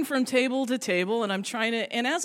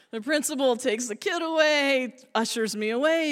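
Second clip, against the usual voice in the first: usual speaking voice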